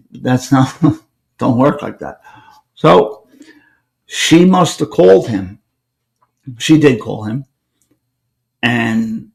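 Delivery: normal talking voice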